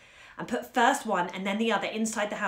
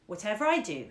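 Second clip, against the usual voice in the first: ordinary delivery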